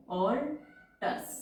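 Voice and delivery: very slow rising alters, each sound stretched; drawn-out word. Shown as a demonstration. Teacher drawing out the word. (Alters को धीरे-धीरे खींचकर)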